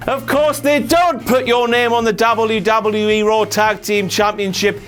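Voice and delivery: ordinary delivery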